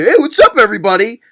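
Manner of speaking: sarcastic imitation of his cheery hello; mock excited greeting. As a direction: parrot the kid's peppy greeting. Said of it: "you didn't say".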